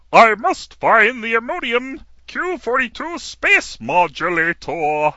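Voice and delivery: high, slow cartoon Martian; cartoon Martian hunting for a gadget; Marvin the Martian voice. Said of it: "I can still do a pretty mean Marvin the Martian".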